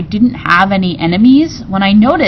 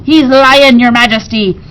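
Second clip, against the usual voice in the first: accusing pirate; 5 semitones higher, 6 dB louder than usual. A narrator's reading.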